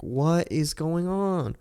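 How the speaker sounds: slow, high, confused question; puzzled disbelief; baffled. Retold or imitated. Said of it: "I'm like".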